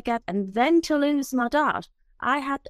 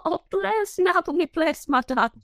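laughing; 6 semitones higher than usual. Real, not acted. Client touched, laughing.

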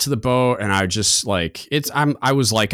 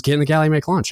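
higher, firmer repeat of the order; insistent repeated order; 7 semitones higher, faster than usual. Retold or imitated. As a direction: say it again, harder, no argument.

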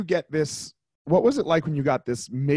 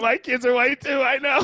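pitched up, half laughing at himself; amused higher repeat; 9 semitones higher than usual. Real, not acted. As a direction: lift the line high with a grin.

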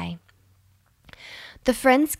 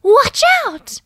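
much higher, louder shout of warning; loud warning call; 8 dB louder than usual. A narrator's reading. Goldfish shouts a warning from the pond. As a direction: yell to stop someone in time. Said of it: "heard a voice call out".

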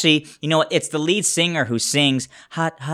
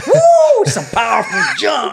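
excited shout; 11 semitones higher, 7 dB louder, slower than usual. Real, not acted. Excited outburst about the song.

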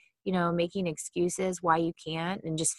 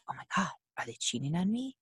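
softer, mildly worried inner voice; insecure; 6 dB quieter, faster than usual. Acted. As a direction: imitate a suspicious, insecure thought.

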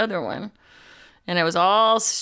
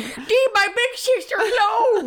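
high, slow, laughing mock outrage; mock protective shout; 4 dB louder, slower than usual. Defending big sister from playground bullies, high laughing mock shout. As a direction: cry it out in pretend defense, laughing.

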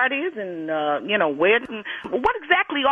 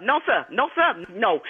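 ordinary delivery

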